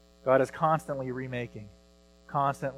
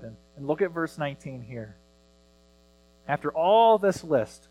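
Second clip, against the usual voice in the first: normal talking voice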